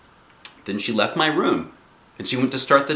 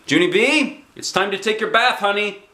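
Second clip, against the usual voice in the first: higher, louder call from another room; hollering mother; 11 semitones higher, 4 dB louder than usual. A narrator's reading. Mother calling Junie B to her bath from afar. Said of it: "Mother's voice hollered to me".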